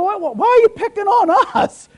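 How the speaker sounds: loud, high-pitched protest; mock indignant complaint. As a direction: plead the grievance, loud and high. Voices indignant complaint of churchgoers.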